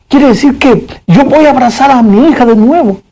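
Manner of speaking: a father suddenly full of hope; joyful father. Acted. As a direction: let new joy lift the voice. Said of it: "la alegría, una emoción nueva".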